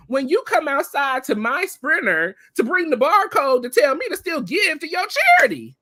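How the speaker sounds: high-pitched, mounting indignation; outraged. Real, not acted. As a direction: build to an incredulous high peak. Outraged at charity ask after ejection.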